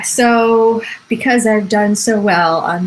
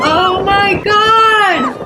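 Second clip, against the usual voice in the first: high-pitched, drawn-out alarm; stretched, raised exclamation of shock; shocked cry; 11 semitones higher, much slower than usual. Real, not acted. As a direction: cry out slowly in real horror. Watches child swept up by kite.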